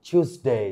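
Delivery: "টিউসডে" as "choos-day"; correct variant. Shown as a demonstration. Teacher recapping variants, "টিউসডে" pronounced "choos-day". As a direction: open "টিউসডে" with a CH sound. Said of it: "এটাও সঠিক".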